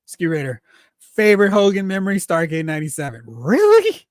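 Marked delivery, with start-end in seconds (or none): 3.2-4.0 big high disbelieving "really"; genuinely taken aback by a chat comment; surprised. Real, not acted. Streamer reacting to a viewer's answer, surprised.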